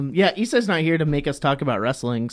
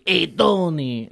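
slow, gruff mock New Yorker, pitched up; gruff New York accent; 4 semitones higher, slower than usual. Shown as a demonstration. Redoing the botched New York take, gruff. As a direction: try to be all gruff, drawn out. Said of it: "trying to be all gruff".

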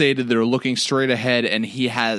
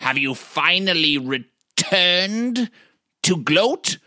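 put-on eccentric old wizard; 4 semitones higher than usual. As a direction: call out like a half-mad hermit wizard. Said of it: "I say out loud".

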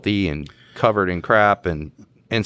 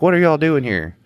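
high-pitched imitated homeowner; mock-indignant question; retold indignant challenge; 8 semitones higher, 4 dB louder than usual. Homeowner confronts officers, retold.

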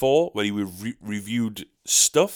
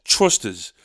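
usual speaking voice

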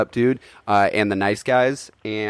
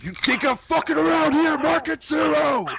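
much higher, fast, shouted rant; furious Walter shouting. Acted. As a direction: lose it completely at the lane.

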